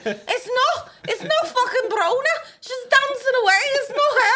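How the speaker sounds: very high, loud Scouse protest; hammed-up indignant fake Scouse shout; put-on Scouse accent. Insisting to friends she's someone else, indignant put-on Scouse. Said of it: "I put an accent on".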